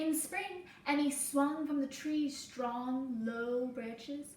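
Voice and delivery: gentle storybook read-aloud for a class; reading to children. Acted. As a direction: tell the story slowly to little listeners. Reading picture book to class.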